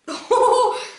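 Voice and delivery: high, loud burst of laughter. Real, not acted. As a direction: burst out laughing at your own stumble. Elle rit de son bafouillage. (éclat de rire aigu)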